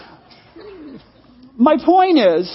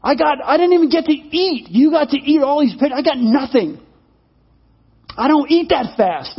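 mildly hammed-up indignant complaint, raised pitch; furious; 4 semitones higher than usual. Horse scolding cat after being stoned, mock furious. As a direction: act out an aggrieved, mock-furious rant. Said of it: "he's furious".

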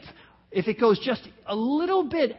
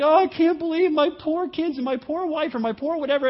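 higher-pitched fake tearful lament; mock crying; 6 semitones higher, 3 dB louder than usual. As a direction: imitate someone putting on grief. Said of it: "and they're crying".

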